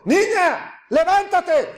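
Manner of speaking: put-on high, loud call to the dead girl; the Messiah shouting to raise the girl; shouted command. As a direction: cry it out with full authority. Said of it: "gritó diciendo".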